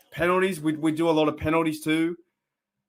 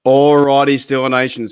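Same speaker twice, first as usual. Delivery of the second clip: much louder, calling out to the fans; loud greeting; 11 dB louder than usual. Real, not acted. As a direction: boom it out to the whole community. Opening shout to his audience.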